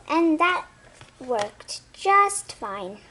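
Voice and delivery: plain reading voice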